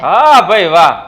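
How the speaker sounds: loud sarcastic exclamation; big, booming mock admiration; mock applause. Husband answers wife's boast of finding another man, sarcastic. Husband retorts in marital squabble.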